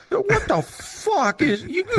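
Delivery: high slow laughing amazement; laughing disbelief. Retold or imitated. Stranger stunned by full body tracking, slow laughing disbelief. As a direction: drag it out, laughing in shock.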